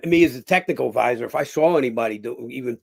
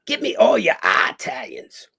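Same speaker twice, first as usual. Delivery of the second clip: imitating the director; 8 semitones higher, 4 dB louder, slower than usual. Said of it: "he says".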